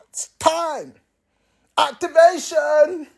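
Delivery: high hyped-up shout; hype shout. Acted. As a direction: call it out like a hype man.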